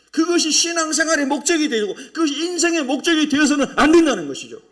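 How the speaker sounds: loud, high-pitched sermon delivery; forceful preaching. Real, not acted. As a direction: preach at full force to the congregation.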